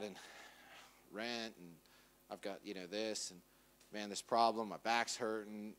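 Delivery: low, soft, downcast grumbling; acted glum muttering under the load; weary complaining. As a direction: list your troubles as if worn down. Acts out weary list of worries.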